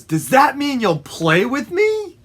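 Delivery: keen animal character voice; eager dog. Acted. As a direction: ask like a pup wanting to play. Dog eagerly asks to play.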